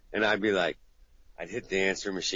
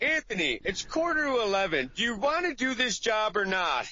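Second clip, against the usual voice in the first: much higher fed-up voicemail; exasperated caller; 12 semitones higher than usual. Retold or imitated. Client's final voicemail threatening the job. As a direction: demand an answer, running out of patience. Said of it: "you're like".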